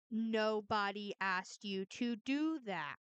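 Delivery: slow, emphatic, every word stretched; exasperated, drawn out. Real, not acted. Streamer hammering a point at their critic, exasperated. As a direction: drag out each word in exasperation.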